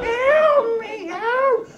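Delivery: very high, tiny pleading squeal; tiny trapped fly. Acted. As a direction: squeak for help like a little fly. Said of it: "there's a tiny fly buzzing around".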